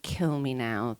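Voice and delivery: low, soft, mock-despairing groan of words; weary misery. Rider retells the end of a long climb, mock misery. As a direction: act utterly fed up, dropping the pitch.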